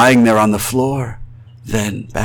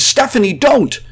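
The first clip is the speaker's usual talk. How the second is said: high-pitched desperate shout; urgent high yell of alarm; 14 semitones higher, 3 dB louder than usual. A narrator's reading. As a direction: cry out to stop a loved one. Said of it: "I shouted with all the might".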